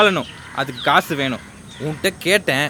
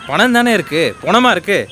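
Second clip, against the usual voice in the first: defensive shout; 9 semitones higher than usual. Acted. Son hit by mother, shouting back defensively. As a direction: push back at her.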